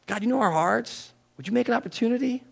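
put-on a hushed prayer spoken for the young people; soft prayer. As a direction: pray it tenderly. Voicing a prayer.

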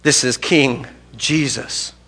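slow and hushed; slow reverent declaration. Real, not acted. Preacher proclaiming Jesus as king, slow and hushed.

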